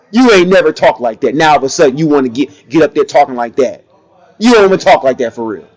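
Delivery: normal talking voice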